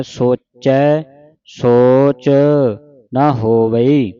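slow recitation, ਸੋਚੈ with open ai; word by word, stretching ਸੋਚੈ and ਹੋਵਈ; double-lavan pronunciation. Shown as a demonstration. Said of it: "ਦੋ ਦਲਾਵਾਂ ਦੇ ਨਾਲ ਪੜ੍ਹਨਾ". (ਸੋਚੈ ਦਲਾਵਾਂ ਨਾਲ, ਹੌਲੀ ਪਾਠ)

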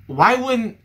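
ordinary talk, nothing marked

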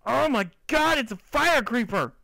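high, loud alarmed shout. Real, not acted. Gamer spotting a fire creeper, high alarmed shout.

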